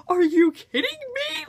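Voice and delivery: high-pitched imitation of an incredulous mom; mock outrage. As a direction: exclaim it way up high in pretend disbelief. Acts out mom's outraged reaction.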